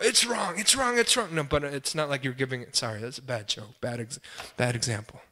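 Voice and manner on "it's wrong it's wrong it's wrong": Oprah. Retold or imitated. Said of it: "It's like Oprah".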